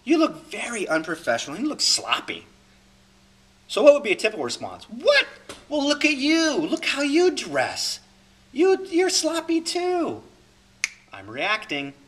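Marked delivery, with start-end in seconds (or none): 0.0-2.5 lightly acted blunt critic, opening raised and loud; pointedly complain, easing off toward the end. Someone criticizing [name]'s way of dressing, mildly acted critic. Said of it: "Someone says to me". 4.8-10.2 high-pitched indignant comeback, dragging out the words; defensive fight reaction. Acted. Reacting to criticism by getting into a fight, indignant. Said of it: "I'm getting into fight".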